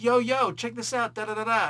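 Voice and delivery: high-pitched mock Italian street guy. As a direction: imitate an Italian guy calling out. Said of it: "supposed to be some Italian guy".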